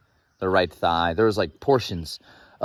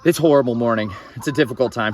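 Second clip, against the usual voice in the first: ordinary talk, nothing marked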